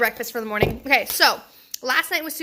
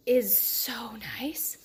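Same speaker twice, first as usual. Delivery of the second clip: hushed, breathy aside; whisper; 7 dB quieter, much slower than usual. Real, not acted. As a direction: lean in and whisper it. Whispered aside about van.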